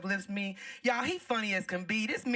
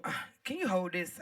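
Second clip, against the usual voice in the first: normal talking voice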